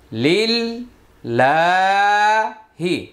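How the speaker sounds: আত্তাহিয়াতু stretched very slowly syllable by syllable; slow, elongated recitation; broken-down slow. Shown as a demonstration. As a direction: draw out আত্তাহিয়াতু for the class. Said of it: "এখানে লম্বা হলো".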